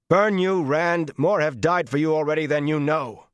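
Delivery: shouted high and loud, raging at Rand; furious outburst. A narrator's reading. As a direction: hurl the words at him in fury. Perrin bursts out at Rand.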